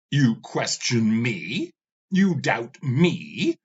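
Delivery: slow, cold challenge; slow menacing rebuke. Acted. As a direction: lean in on each question. Ravana rebukes the skull.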